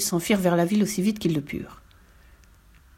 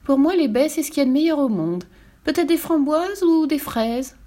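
piping bird; 7 semitones higher than usual. A narrator's reading. The bird keeps speaking.

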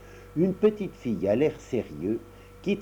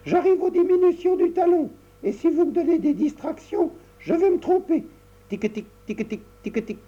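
put-on busy little girl; 4 dB louder than usual. Tricotta concentrating on her knitting.

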